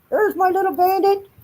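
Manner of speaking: high cooing pet voice. Real, not acted. Owner cooing at her dog, high baby talk. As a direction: coo at the little dog.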